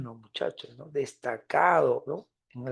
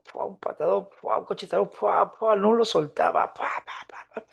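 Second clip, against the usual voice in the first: punching noises; 4 semitones higher than usual. Retold or imitated. Teacher imitates blows of beating.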